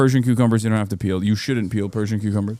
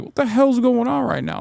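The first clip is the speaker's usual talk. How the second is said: incredulous; 12 semitones higher than usual. Retold or imitated. Recounting the cucumber guy to viewers, high incredulous shout. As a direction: pitch way up in disbelief. Quoted disbelief at the dude.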